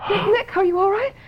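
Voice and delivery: high, tearful cry for him; terrified plea over his body; panicked crying. Acted. Husband fallen, bleeding.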